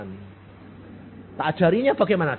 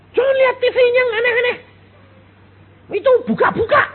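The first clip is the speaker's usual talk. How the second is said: much higher, louder, fast nagging mother voice; 9 dB louder, faster than usual. Retold or imitated. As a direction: mimic a fussy mum scolding her kid. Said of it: "ibu-ibu kerap kali gini". (menirukan ibu yang cerewet dengan suara tinggi dan cepat)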